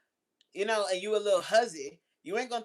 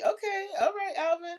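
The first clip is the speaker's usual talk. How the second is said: mock teasing; 11 semitones higher than usual. Imitating Alvin's friend teasing.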